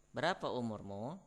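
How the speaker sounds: usual speaking voice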